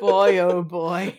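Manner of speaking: drawn-out chuckle through the words; slow and amused, laughing; laughing slowly. Real, not acted. Laughing after the joke.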